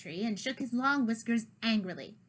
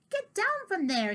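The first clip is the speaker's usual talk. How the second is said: put-on stern old cat; 10 semitones higher, 4 dB louder than usual. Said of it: "he mewed".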